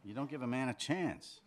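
high, loud complaint; exasperated. Acted. Man complaining his lover won't wait, exasperated. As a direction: protest at being rushed.

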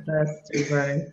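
ordinary talk

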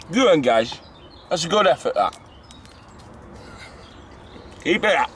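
loud, high encouraging call; cheering on. Real, not acted. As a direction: call out praise to runners. Cheering on passing joggers.

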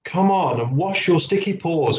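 high, lightly called instruction; calling out. A narrator's reading. Mum telling Billy to wash, calling out. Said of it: "called Mum".

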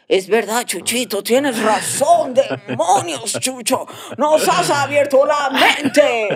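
slow, drawn-out mock enlightenment; mock awe. Subordinates giving in to Chucho, mock awe. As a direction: stretch every word in exaggerated revelation.

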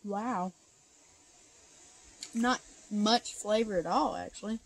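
chewing while talking; speaking around candy; mouth full. Real, not acted. Seen 0: Boy eats candy pieces; pursed chewing mouth between words, holds piece.

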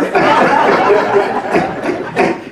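a high breathy burst of laughter. Real, not acted. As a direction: let the laugh spill out high. Loud laugh after punchline.

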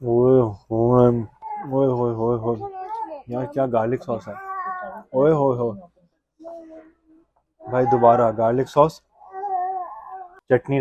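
talking while chewing. Real, not acted. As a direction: mouth partly full of food. Seen 1: Puts food in mouth just before; keeps eating rice throughout.

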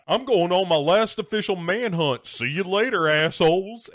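loud, high mock sign-off; hammed-up defiant radio farewell; cocky henchman. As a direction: announce your exit with swagger. Henchman signing off radio, acted.

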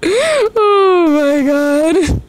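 high, drawn-out oh my god dissolving into laughter; laughing. Real, not acted. Artist cracks up at her ugly doodle, high slow laughing groan. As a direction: let the laugh take over, very slowly.

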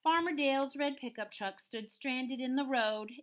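lively, swinging storybook narration; story read-aloud. A narrator's reading. Teacher reading a rhyming farm story to pupils, lively read-aloud. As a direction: bounce through the rhyme for young listeners. Reads picture book to children.

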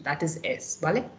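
ordinary talk, nothing marked

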